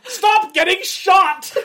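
high, loud and slow mock shout; hammed-up shout, half laughing; mock yell. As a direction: yell it like scolding the screen. Mock yelling at movie character.

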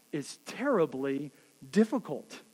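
ordinary talk

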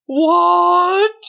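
loud, high, drawn-out bellow; confused troll. A narrator's reading. Troll answers from under the bridge, loud confused bellow. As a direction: bellow it out in bafflement. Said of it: "said the voice of the troll in confusion".

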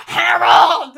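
loud, high mock shout of "Harold!"; mock shriek. Retelling the deer video, loud alarmed call. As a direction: call out in alarm like the old lady at the sneeze. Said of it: "she's like".

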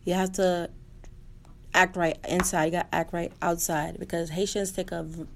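ordinary talk

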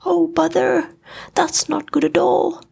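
high, worried bear voice; high and fretful, for a friend; concerned Pooh. A narrator's reading. Pooh worried about his friend Eeyore, high and fretful. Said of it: "concerned for his friend".

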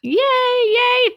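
high, drawn-out little yay; excited cheer. Real, not acted. Host cheering as the guest says she's delighted, excited.

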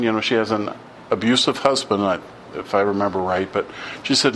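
usual speaking voice

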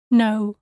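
no with an English O gliding into U; English glided o. Shown as a demonstration. Teacher showing the wrong English O in "no". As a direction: let the O slide toward U, English style. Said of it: "And not like in the English word".

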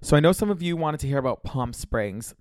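normal talking voice

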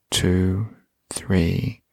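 whispered count; whispering. Shown as a demonstration. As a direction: hush the count while holding.

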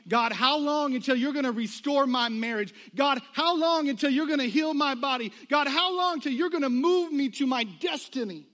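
loud, mounting plea with every "how long"; anguished plea. Acted. Struggling believers crying to God, loud desperate plea. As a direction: cry out to God, loud and high.

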